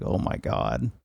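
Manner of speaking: very low, soft aside; hushed mock dismay. Viewer watching him piss blood, low hushed reaction. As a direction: drop it low and quiet, as if appalled.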